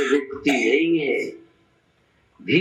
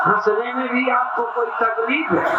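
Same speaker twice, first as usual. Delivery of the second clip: exuberant laughing exhortation; 5 semitones higher than usual. Real, not acted. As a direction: cheer them into real laughter. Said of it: "हंसो, सचमुच हंसो".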